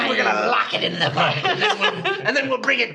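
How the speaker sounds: hammed-up corporate voice, laughing; mock greedy company voice. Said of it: "And not".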